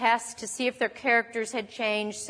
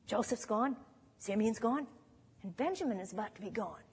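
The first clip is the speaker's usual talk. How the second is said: hushed; 11 dB quieter than usual. Real, not acted. Recounting Jacob's losses.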